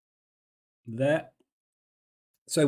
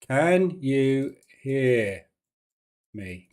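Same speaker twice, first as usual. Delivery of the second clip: very slow, drawn-out words; saying while writing; much slower than usual. Real, not acted. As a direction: spell it out word by word as you write. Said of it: "I'm gonna write on the screen".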